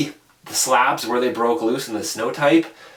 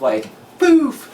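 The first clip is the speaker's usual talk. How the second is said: much higher, louder playful "boof" noise; whoomp sound effect; 14 semitones higher, 4 dB louder than usual. Retold or imitated. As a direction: toss out a quick impact sound. Mimics avalanche sweeping him.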